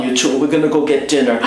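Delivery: ordinary talk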